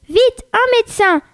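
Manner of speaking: high-pitched call, not truly shouted; mild storybook cry. A narrator's reading. Mother calling for a doctor, mild mock shout. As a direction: call urgently for help, lightly acted. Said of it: "cria Madame Beauregard".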